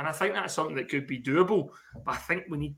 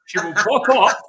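ordinary talk, nothing marked

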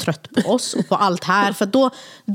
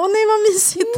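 very high cooing squeal; mock-swoon over sleeping babies, high; gushing; slower than usual. Mother passing the sleeping babies at night, gushing.

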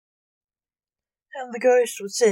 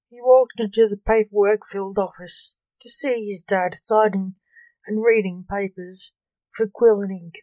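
plain reading voice

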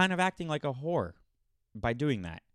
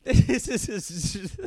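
laughing; 9 semitones higher, 7 dB louder, slower than usual. Real, not acted. Laughing in disbelief.

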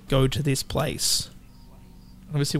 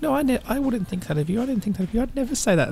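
defensive denial; 8 semitones higher, faster than usual. Retold or imitated. Acting out defensive denial on tape.

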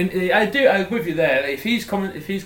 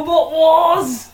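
incredulous; 13 semitones higher, 9 dB louder, much slower than usual. Real, not acted. Incredulous echo.

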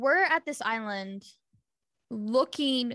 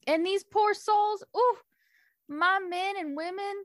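higher-pitched country lament; country accent; 7 semitones higher than usual. Acted. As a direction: plead for your people in a country twang. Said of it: "natives here have a country accent".

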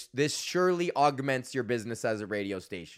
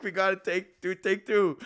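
higher, through a laugh; laughing take two; 6 semitones higher than usual. Retold or imitated. Groom retelling his call for a second take, chuckling. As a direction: chuckle while saying it. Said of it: "So I'm like".

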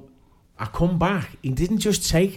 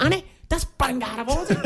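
laughing mock scolding, higher; dad laughing at his daughter's team switch, mock outrage; 11 semitones higher than usual. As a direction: tell her off while cracking up. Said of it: "and I went".

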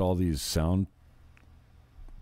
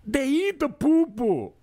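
high, slow, much higher mock accent; mock African preacher; 4 dB louder, slower than usual. Imitating the Ugandan preacher's accent on eat and poo-poo. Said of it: "it's like".

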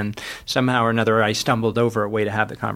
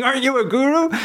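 much higher mock-incredulous question; journalist's question; 14 semitones higher than usual. Journalist asking the author if he's a guru, high teasing imitation. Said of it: "he's saying".

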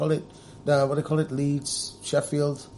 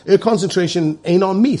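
ordinary talk, nothing marked

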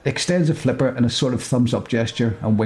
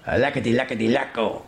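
mock fish language. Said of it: "he says".

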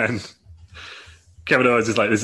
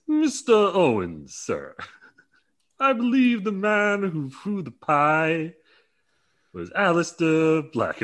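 high, slow, grand announcing voice; Keith Lee impression; 8 semitones higher, 3 dB louder, much slower than usual. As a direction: draw each word out, lofty and solemn. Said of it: "'cause that's how he talks".

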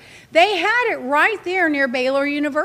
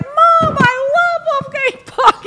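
put-on high, slow, gushing kid voice; excited son; 14 semitones higher, 5 dB louder, slower than usual. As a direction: play the son gushing over the food.

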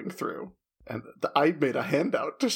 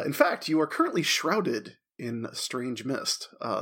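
normal talking voice

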